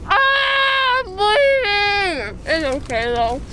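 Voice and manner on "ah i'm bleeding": crying out in alarm, slow; real panicked wail. Real, not acted. Panics at seeing blood.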